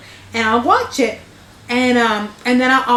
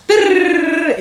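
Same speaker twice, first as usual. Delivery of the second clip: rolled r flourish; 7 semitones higher, 4 dB louder than usual. Retold or imitated. Imitating commercial rolled-r flourish.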